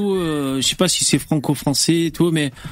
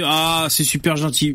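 normal talking voice

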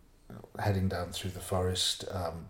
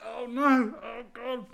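mortified dismay. Retold or imitated. Storyteller realising what he had been saying, high mortified groan. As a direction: groan as your blunder dawns on you. Realises his embarrassing word mistake.

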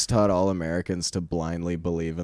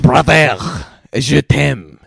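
put-on loud, high, over-the-top French accent; exaggerated French accent, shouted; hammed-up French accent; 6 semitones higher, 10 dB louder, much slower than usual. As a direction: belt it out in a mock French accent. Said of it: "let me try that again".

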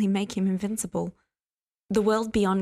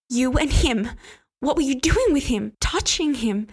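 higher, louder jealous outburst; angry accusation; 5 semitones higher, 4 dB louder than usual. Acted. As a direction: fling the accusation at him.

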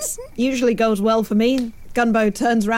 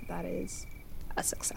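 a theatrical vampire hush; mock vampire whisper; 6 semitones lower, 18 dB quieter than usual. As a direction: drop to a hushed, spooky whisper. Vampire brag whispered dramatically.

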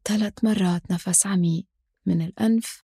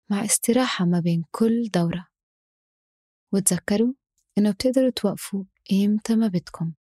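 ordinary delivery

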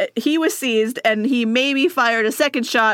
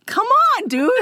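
much higher, whiny plea; the shot prince whining at Australia; mock hurt prince; 11 semitones higher, slower than usual.

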